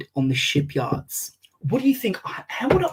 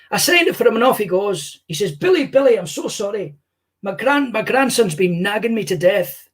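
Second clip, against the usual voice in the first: higher Scottish voice, telling a Billy story; Billy Connolly impression; 6 semitones higher than usual. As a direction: play Billy Connolly spinning a yarn, pitched up. S0 answers question as Billy Connolly.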